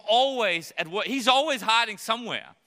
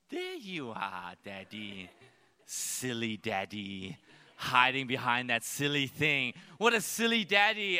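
put-on toddler daughter voice; 9 semitones lower than usual. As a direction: coo it like a little girl teasing daddy. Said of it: "she goes".

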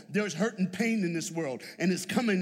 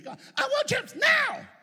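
put-on high, loud, slow whining child; child tantrum; 7 dB louder, slower than usual. Boy begging Mama for chips, high whining shout. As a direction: wail it like a spoiled kid.

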